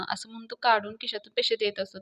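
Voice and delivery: usual speaking voice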